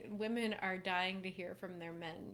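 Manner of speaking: usual speaking voice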